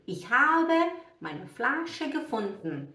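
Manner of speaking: slow model sentence ending "gefund-n"; standard German, E dropped. Shown as a demonstration. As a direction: say gefunden without its last vowel, slowly. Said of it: "A gente fala gefunden".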